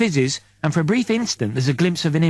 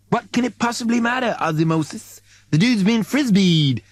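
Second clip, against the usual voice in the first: mocking crewmate; 7 semitones higher than usual. Crew member voiced in story.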